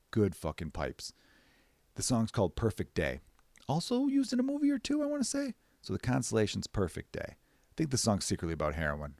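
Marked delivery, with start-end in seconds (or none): 3.7-5.5 up in a squeaky falsetto; high silly voice. Real, not acted. Aside to listeners in a squeaky high voice. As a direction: toss it off in a cartoonish high voice.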